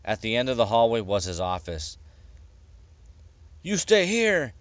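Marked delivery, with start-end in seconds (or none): 3.6-4.5 high, curt order to the guard; commanding captain. A narrator's reading. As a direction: snap the order, pitched up. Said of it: "he told the guard".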